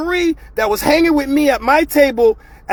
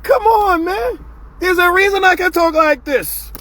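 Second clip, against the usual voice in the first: retold shout; 7 semitones higher than usual. Retold retort to friends.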